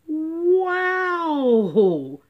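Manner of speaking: high, loud gleeful whoop; yelled. Acted. Kids cheering that their teacher is absent. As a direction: yell it like kids let loose. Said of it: "yelled the kids".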